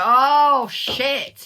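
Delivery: loud, high startled "Oh, shit"; shocked outburst. Retold or imitated. Partner caught off guard, loud high shocked exclamation. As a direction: blurt it out like the floor just dropped. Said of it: "making them go".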